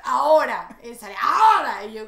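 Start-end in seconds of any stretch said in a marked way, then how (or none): 0.0-0.8 sudden loud mock shout of one word; grito imitado. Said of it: "le estoy gritando solo esa palabra". 1.2-1.8 higher, very loud mock shout; grito imitado. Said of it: "le estoy gritando solo esa palabra".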